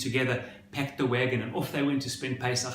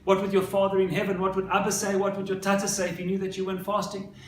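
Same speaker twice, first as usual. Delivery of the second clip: high-pitched retold plea, pressing urgently; pleading, crying mother; 6 semitones higher than usual. Crying mother begs her son to fast, retold urgent plea. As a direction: imitate an upset mother pleading, without real tears. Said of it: "the mother is crying and she's saying".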